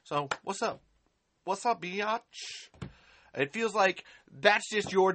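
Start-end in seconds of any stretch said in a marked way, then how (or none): 1.4-2.7 slow, sassy slang greeting; drawn-out mock street greeting. To a hater in the chat, slow teasing slang greeting. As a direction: stretch it out slowly, cocky and teasing.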